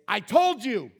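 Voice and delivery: mock scolding. God pictured scolding Jonah, mock scolding. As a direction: snap it like an exasperated parent. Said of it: "he just doesn't say".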